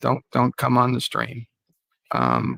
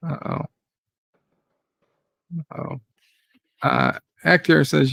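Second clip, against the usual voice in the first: ordinary talk, nothing marked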